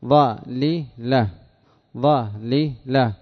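"ظاللة" very slow, each syllable standing apart. Shown as a demonstration. Teacher showing students the root form, syllable by syllable. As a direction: split "ظاللة" into three separate drawn-out syllables. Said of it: "ما أصلها؟".

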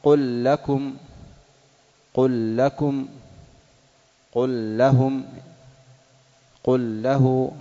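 قل لكم and قل ربي with the lam merged into the next letter; recited examples. Shown as a demonstration. Teacher reciting Quran examples of lam assimilation for students. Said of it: "نحو".

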